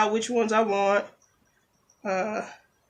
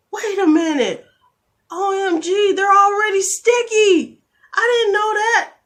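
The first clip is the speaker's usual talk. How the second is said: much higher, louder shocked squeal; amazed squeal; 12 semitones higher, 9 dB louder than usual. Real, not acted. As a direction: gush like you just found a secret. Discovers the nails already sticky.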